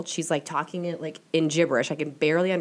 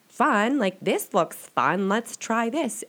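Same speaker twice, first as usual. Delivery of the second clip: high, bubbly, eager; light up at a new hobby; excited; 6 semitones higher, slower than usual. Retold or imitated. Deciding to try tarot.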